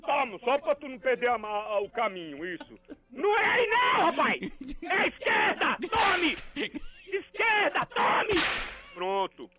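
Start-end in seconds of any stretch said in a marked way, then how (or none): 3.1-8.4 higher shouted directions; comic boss shouting left; hammed-up yelling. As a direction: bark the way while lashing.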